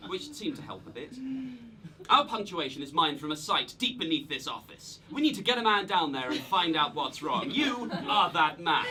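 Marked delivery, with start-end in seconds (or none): 2.1-8.8 general's mission briefing in a put-on American accent; loud American commander, slowing portentously at the end; ridiculous American accent. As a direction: give orders like a hammed-up American general. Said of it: "Why are you doing that ridiculous accent?".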